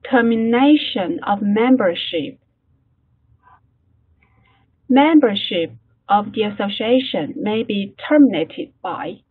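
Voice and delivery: slow, deliberate English read-aloud for learners; model reading. Shown as a demonstration. Teacher models the text for class, slow careful reading.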